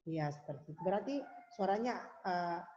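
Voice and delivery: ordinary talk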